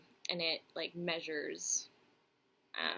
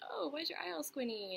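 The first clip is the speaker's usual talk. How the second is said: high-pitched mimicry of a questioner; imitating nosy onlooker; 9 semitones higher than usual. People asking about the squinting eye, higher-pitched imitation. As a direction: put on a higher voice for their question. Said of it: "they'll be like".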